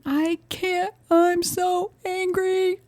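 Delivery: slow, high mock whine; imitating upset child. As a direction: voice a little boy too upset to calm down. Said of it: "trying to soothe him".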